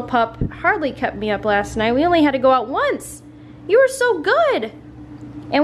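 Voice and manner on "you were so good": puppy praise. Real, not acted. Owner praising her puppy, high baby talk. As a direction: gush up high at the pup.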